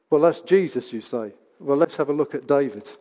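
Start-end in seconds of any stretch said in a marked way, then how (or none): none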